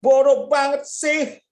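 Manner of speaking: high, slow, loud sneer of "stupid"; drawn-out mocking jab; scornful insult. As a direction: hurl it at a classmate with contempt. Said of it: "anak ini berikan begini".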